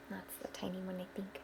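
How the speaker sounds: soft whispered aside. Real, not acted. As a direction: whisper it as if sharing a secret. Whispered aside to listening children.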